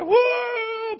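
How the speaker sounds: high, loud whooping cry of joy; joyful church shout. Retold or imitated. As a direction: let out a wild celebratory woo. Church erupting, Pentecostals broke out.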